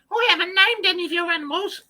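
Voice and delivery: high girly mock daughter voice; squeaky childish impression of the daughter; lightly mocking a young daughter; imitating daughter. Voicing daughter.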